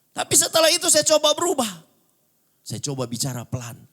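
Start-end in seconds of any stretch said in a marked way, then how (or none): 0.1-1.7 high, loud proclamation; loud preaching. Real, not acted. Preacher testifying about changing his old voice, loud preaching. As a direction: lift the voice and declare it to the room. Preacher surges at turning point.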